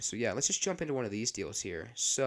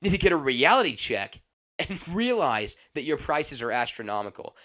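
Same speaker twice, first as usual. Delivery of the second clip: loud, higher-pitched indignant tirade; mock angry rant; 5 semitones higher, 11 dB louder than usual. Imitating old customer ranting about popcorn prices, loud indignation. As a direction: go off like a fed-up customer. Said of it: "Man just starts going off on a rant".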